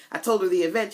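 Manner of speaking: ordinary talk, nothing marked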